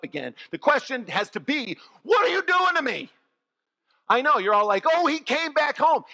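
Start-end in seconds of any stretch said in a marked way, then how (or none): 2.0-3.1 high, loud exasperated complaint; a hammed-up, put-upon Lazarus; indignant protest. Lazarus protesting his return. 4.9-6.0 mock sentimental. Mocking congregation's sweet assumption.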